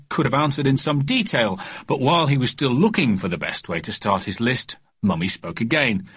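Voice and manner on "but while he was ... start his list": usual speaking voice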